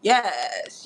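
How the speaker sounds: usual speaking voice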